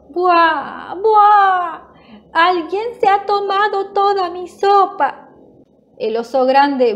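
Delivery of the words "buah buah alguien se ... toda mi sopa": hammed-up little bear wailing, high-pitched; bursting into tears. A narrator's reading. Little bear finds his bowl empty, mock crying. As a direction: bawl like a storybook cub in a high voice. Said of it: "rompe a llorar".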